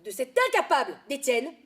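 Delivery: loud angry snap at a colleague; angry outburst. Acted. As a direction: spit out the blame, loud. Blaming a colleague angrily.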